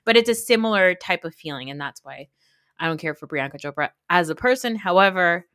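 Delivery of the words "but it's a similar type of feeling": usual speaking voice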